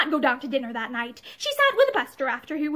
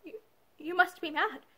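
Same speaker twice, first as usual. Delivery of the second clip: hoarse; 3 semitones higher, 6 dB quieter than usual. A narrator's reading. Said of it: "Pansy said again hoarsely".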